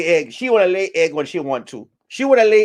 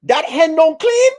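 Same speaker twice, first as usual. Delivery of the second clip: outraged old goat reacting to gossip; shocked goat; 13 semitones higher, 3 dB louder than usual. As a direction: gasp it out in disbelief, pitched way up. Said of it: "Oxtail go".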